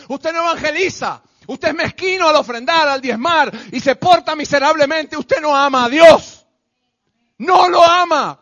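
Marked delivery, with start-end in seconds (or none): 5.2-8.4 loud, slow, accusing shout; thundering rebuke. Real, not acted. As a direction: hammer it at the congregation. Preacher rebukes the congregation.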